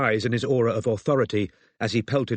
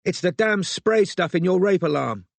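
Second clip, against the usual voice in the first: high, exasperated raised voice; calling out; 8 semitones higher than usual. A narrator's reading. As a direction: call an explanation after someone leaving. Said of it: "Robin called".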